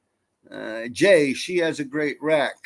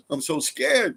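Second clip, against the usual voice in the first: mock scared gasp; 11 semitones higher, 5 dB louder, slower than usual. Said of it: "I'm like".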